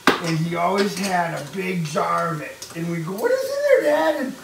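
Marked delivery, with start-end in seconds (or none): 3.1-4.2 put-on much higher, louder wondering kid voice; curious kid question. Kids asking dad about his salt jar, curious. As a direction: ask dad wide-eyed.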